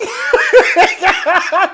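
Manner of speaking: loud, high burst of laughter; real cackle. Real, not acted. Host cracking up at the retold phone call, loud cackle.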